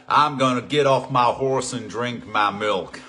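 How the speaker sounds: slow, drawling cowboy talk; cowboy accent. Acted. Host shows off to the class in a slow cowboy accent. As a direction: put on a hammed-up cowboy drawl. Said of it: "my cowboy accent is really good".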